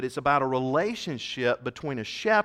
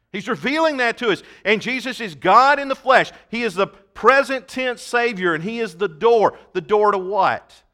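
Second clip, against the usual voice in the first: raised, loud preacher's delivery; forceful preaching; 6 semitones higher, 8 dB louder than usual. Real, not acted. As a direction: drive the point home, high and loud.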